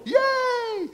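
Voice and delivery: very high, loud little "yay"; mock cheer. Mock cheer at upcoming marathons.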